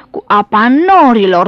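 loud, swelling voice of the wind; whooshing wind. A narrator's reading. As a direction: howl it out like wind. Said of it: "vâjii vântul".

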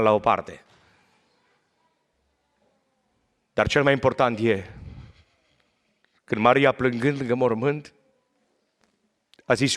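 low, hushed retelling of the scripture. Acted. Soft scripture storytelling.

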